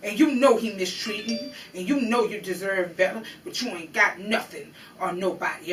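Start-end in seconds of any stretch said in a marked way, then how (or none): none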